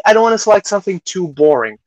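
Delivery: ordinary delivery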